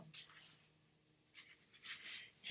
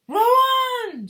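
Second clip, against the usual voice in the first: much higher, far louder shout; shouted command; 17 dB louder than usual. Acted. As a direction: yell it to the rowers with full force. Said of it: "shouted Mister Wonka".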